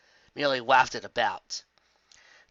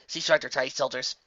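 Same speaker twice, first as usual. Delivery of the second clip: plain reading voice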